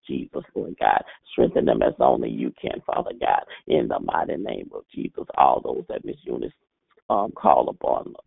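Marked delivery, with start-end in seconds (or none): none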